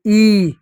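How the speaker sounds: ई sustained as "eeee"; drawn-out ई; long vowel. Shown as a demonstration. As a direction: hold ई long with bared teeth. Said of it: "लंबा उच्चारण होगा".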